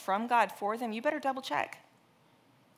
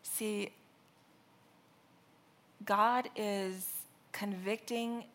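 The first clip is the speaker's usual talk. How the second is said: ordinary talk, nothing marked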